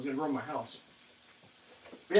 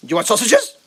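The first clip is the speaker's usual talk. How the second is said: a little higher, eager question to the dog; pet talk; 5 semitones higher, 4 dB louder than usual. Real, not acted. Asking his dog Berta again, eager pet talk. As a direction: coax the dog once more.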